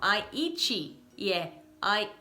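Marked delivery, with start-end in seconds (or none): 0.0-1.0 "eat" as EE-chee; added final vowel. Shown as a demonstration. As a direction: add chee at the end of "eat". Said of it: "acrescentando um i no final".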